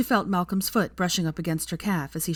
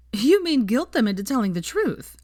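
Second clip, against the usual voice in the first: blunt; 6 semitones higher, 5 dB louder than usual. A narrator's reading. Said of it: "said Veronica baldly".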